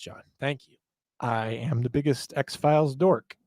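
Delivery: normal talking voice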